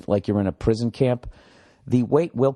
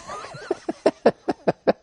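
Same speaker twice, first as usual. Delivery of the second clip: high-pitched giggle. Real, not acted. Laughs after free gym.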